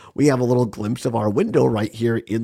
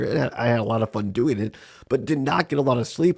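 ordinary delivery

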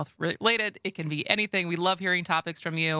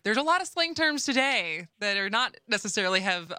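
ordinary talk, nothing marked

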